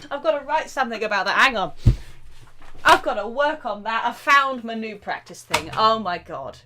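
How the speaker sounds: real delight, fast and loud; thrilled. Real, not acted. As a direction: gush over a new find. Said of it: "how happy I am about that".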